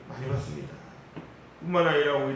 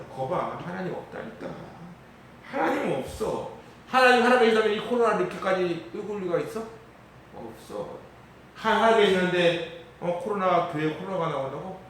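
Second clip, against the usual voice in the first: higher, sneering voice of a scoffer; mock scornful unbeliever; scoffing unbeliever; 5 semitones higher than usual. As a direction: jeer that God doesn't exist. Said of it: "이런 식이야".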